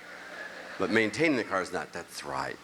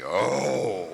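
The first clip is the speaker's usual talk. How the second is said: excited oh. Acted. Excitement about tractor.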